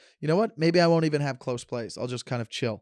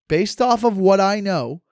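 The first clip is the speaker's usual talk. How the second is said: "based off of what I know" louder, higher and slow; emphatic repeat; 4 semitones higher, 7 dB louder, slower than usual. Real, not acted. Host insisting on his point to his co-host, louder and slow.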